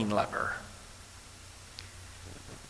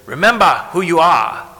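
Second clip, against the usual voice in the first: loud, emphatic Rafiki line; playing Rafiki; 3 semitones higher, 15 dB louder than usual. Preacher as Rafiki to the church, loud. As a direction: bellow it like Rafiki whacking Simba. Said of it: "Can I be Rafiki for a little bit?".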